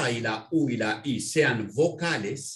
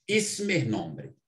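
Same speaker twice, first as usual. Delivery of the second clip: slow, deliberate, every word clear; slow teacher voice; slow teaching demo; much slower than usual. Shown as a demonstration. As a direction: spell it out slowly for a beginner. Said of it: "la palabra ism".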